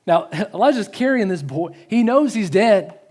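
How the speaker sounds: ordinary talk, nothing marked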